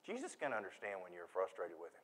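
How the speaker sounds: normal talking voice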